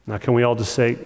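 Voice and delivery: ordinary delivery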